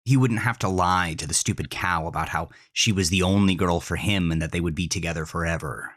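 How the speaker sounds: plain reading voice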